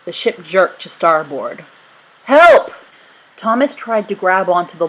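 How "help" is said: high, loud panicked cry; cry for help. Acted. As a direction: scream as he slips. Falling from the rigging.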